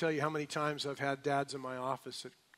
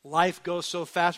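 normal talking voice

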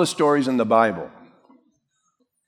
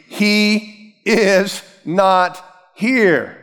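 higher, drawn-out words with pauses between; very slow, emphatic; 6 semitones higher, much slower than usual. Shown as a demonstration. Preacher drives home the third point to congregation, slow and emphatic. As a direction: hammer it home one word at a time.

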